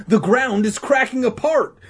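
high, loud cry of alarm. Acted. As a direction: cry out in a panic to the room. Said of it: "Danny cried".

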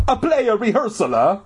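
high Italian janitor; bad Italian accent. A narrator's reading. Janitor doubts the kids' excuse, mock Italian accent. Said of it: "make it sound like he was Italian".

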